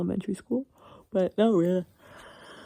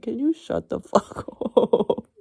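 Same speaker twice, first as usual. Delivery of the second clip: mock snap at herself; 5 semitones higher, 5 dB louder, slower than usual.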